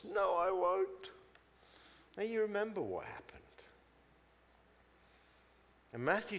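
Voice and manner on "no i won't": slow, high, insistent refusal; stubborn denial. Acted. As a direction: dig in with a drawn-out denial. Peter stubbornly denies the prediction.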